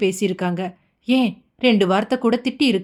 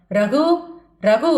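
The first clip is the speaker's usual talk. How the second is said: calling out angrily; 5 semitones higher, much slower than usual. Acted. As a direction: summon him sharply, rising in volume. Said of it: "ரகுவின் பெயரை ஏலம் விட்டது போல மூன்று முறை".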